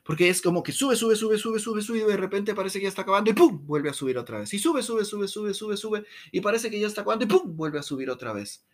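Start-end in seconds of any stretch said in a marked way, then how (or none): 1.0-3.7 higher and higher, rushing, then a sudden pum; nonstop rising talk. Shown as a demonstration. Mocking people who talk without pause, rushing upward. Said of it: "es que a veces la gente habla así". 4.5-7.5 higher and higher, rushing, then a sudden pum; nonstop rising talk. Shown as a demonstration. Mocking people who talk without pause, rushing upward. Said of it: "es que a veces la gente habla así".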